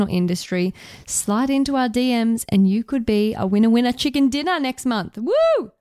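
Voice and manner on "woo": high-pitched whoop; high cheering woo; celebratory cheer. Real, not acted. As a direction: let out a quick "woo" of celebration. Cheering the prize giveaway.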